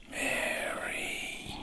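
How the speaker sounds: unvoiced breath of a name; faint whisper from the dark doorway; ghostly whisper.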